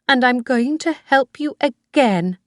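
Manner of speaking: put-on high old woman's voice, clinging and sweet; Missus Harvey, doting old mother.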